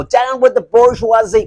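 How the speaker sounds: high, loud mock American rallying cry; American Spart accent. Lecturer parodying tankie slogans, loud mock American accent. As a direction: stretch the slogan out in fake American. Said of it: "doing the American Spart accent".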